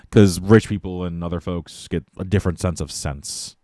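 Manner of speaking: normal talking voice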